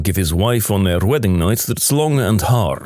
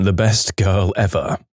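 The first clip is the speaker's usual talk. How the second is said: plain reading voice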